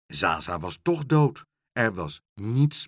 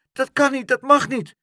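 high, loud, insistent protest; outraged cry; 9 dB louder than usual. A narrator's reading. Pluk protests losing his house, outraged cry. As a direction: shout in disbelief at the injustice. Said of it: "riep Pluk".

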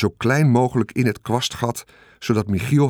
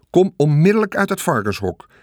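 high, insistent raised-voice order; mother's sharp call; 6 semitones higher, 4 dB louder than usual. A narrator's reading. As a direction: order the child out sternly. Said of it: "riep mevrouw Gersteman". (streng geroepen bevel van moeder)

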